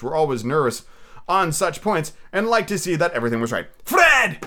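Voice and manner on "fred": loud, high call of a name; exuberant cry. Acted. Scrooge surprises his nephew at the door, exuberant cry. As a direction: burst out calling for him. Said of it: "cried Scrooge".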